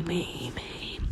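faintly voiced, trailing ghost echo; ghostly echo. Ghost boy pleading for a playmate, fading echo. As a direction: let the words fade away softly like an echo. Echoing tail of ghost's plea.

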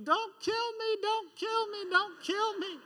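put-on a flailing kid screaming as he's carried; screaming child. Boy carried by his dad, screaming in fear. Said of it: "kicking and flailing and screaming".

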